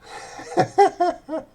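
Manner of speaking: very high loud mock laughter; giddy laugh. Shown as a demonstration. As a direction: cackle with glee.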